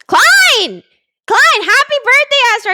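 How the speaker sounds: high, loud excited call; excited greeting. Real, not acted. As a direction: call out her name excitedly.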